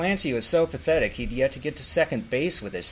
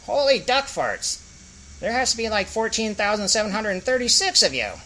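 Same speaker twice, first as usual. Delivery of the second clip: higher stunned exclamation; surprised duck-orc voice; 10 semitones higher than usual. Acted. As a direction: gasp out the shock. Clancy voiced, shocked.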